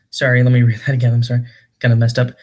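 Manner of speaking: usual speaking voice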